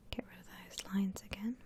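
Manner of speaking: ordinary delivery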